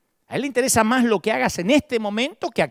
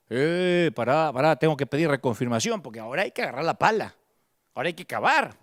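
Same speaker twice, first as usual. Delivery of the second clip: lower, quick mock excuse; mocking a stalling believer; 5 semitones lower than usual. Preacher mocking a believer stalling when told to dig, lower quick. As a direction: rattle off the excuse lower and hurried.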